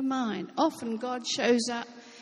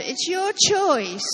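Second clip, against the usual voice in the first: slow, punchy, higher on choice; emphatic; 6 semitones higher, 7 dB louder, slower than usual. Real, not acted. Preacher driving home to the congregation: slow, emphatic "choice". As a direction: lean hard on "choice", slow and loud.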